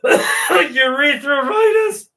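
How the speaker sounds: squealed high through laughter; can't get the word out for laughing; drawn-out laughing squeal. Real, not acted. Cracking up over the word.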